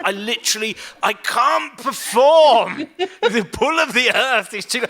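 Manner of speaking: high, loud, drawn-out comic wail; mock despair. Bread-stuffed performer before the gig, wailing in mock despair. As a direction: wail it out in hammed-up anguish.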